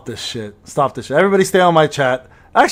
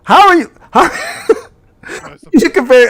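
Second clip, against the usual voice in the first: laughing disbelief; 9 semitones higher than usual. Real, not acted. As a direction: voice jumps high and breaks into a laugh. Laughing in disbelief mid-argument.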